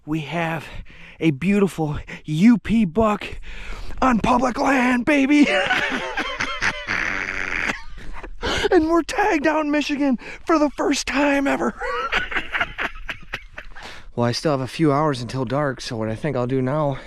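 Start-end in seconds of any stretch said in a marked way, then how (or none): 0.0-5.5 higher, louder, slow celebratory shout; whooping victory cry; triumphant shout. Real, not acted. Celebrating the buck. 5.5-8.7 high joyful laugh. Real, not acted. Celebrating the buck. 8.7-11.7 much higher, louder, elated announcement; beaming, still laughing inside; giddy high announcement. Real, not acted. Celebrating the buck. 11.8-14.1 shrieking high laugh. Real, not acted.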